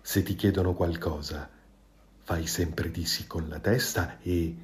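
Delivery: low, soft, crafty advice; cunning tone. A narrator's reading. The fox slyly coaching the goose, soft and low. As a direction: whisper the trick like a schemer. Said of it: "suggerì in tono astuto".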